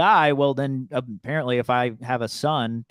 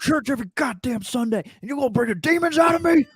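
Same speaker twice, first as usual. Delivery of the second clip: much higher, fast, building to a shout; mock furious rant; 13 semitones higher, faster than usual. Quoting an enraged preacher to his friend, fast, building to a shout. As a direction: rant faster and faster until you scream. Quoting Danny McBride in Gemstones.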